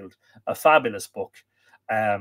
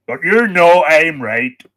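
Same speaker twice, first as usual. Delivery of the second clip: put-on loud, slow Ulster accent, pitched up; mock Ulster accent; 4 semitones higher, 10 dB louder, slower than usual. Host answering imagined Northerners, loud mock Ulster accent. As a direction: insist in a hammed-up Ulster accent.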